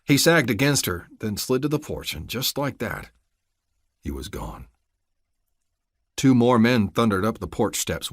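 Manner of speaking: usual speaking voice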